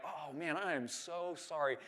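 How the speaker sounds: softly, slowing on the apology; retold apology. Man backing off from an upset surfer, apologetic. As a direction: back off with a soft, drawn-out apology. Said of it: "back off, and I'm like".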